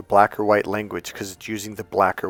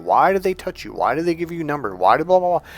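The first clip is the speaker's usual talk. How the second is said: higher-pitched whiny questioning; mock bewildered guy voice; imitated baffled guys; 6 semitones higher, faster than usual. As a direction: pester with puzzled, piled-up questions. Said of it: "a lot of guys are like".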